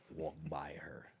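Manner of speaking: whispered gossip; hushed aside. Real, not acted. Describing the new guy walking by, whispered. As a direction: breathe it like a secret.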